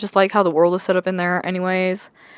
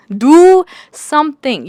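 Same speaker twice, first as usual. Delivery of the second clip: louder, higher and slow, hitting "Do" hard; exasperated louder repeat; 5 semitones higher, 8 dB louder, much slower than usual. Real, not acted. A fed-up reader ordering the book characters to act, louder and slower. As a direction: drag each word out like a frustrated command.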